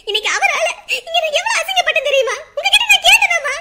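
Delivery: high, loud, anguished anger; hurt outburst. Acted. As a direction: build to a wounded shout. Humiliated daughter explodes at parent. (வேதனையான கோபத்துடன்)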